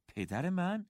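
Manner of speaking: high-pitched surprised question; voiced boy's surprise. A narrator's reading. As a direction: ask it in astonishment, pitch jumping up. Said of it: "مایک با تعجب پرسید".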